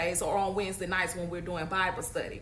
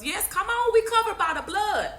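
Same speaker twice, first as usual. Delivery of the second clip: high, louder burst of faith; fervent exclamation; 11 semitones higher, 5 dB louder than usual. Real, not acted. Livestream preacher affirming faith to viewers, fervent exclamation. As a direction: cry it out in fervent agreement.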